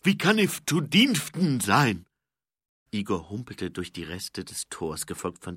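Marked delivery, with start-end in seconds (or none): 0.0-2.1 high, loud, slow servant's lisp. A narrator's reading. Igor offering his services to Mumm, lisping servant.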